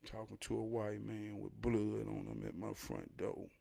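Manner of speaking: normal talking voice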